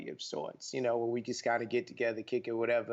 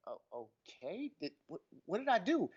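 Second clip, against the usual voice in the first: confused self-check; 5 semitones higher than usual. Retold or imitated. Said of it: "I'm checking myself like".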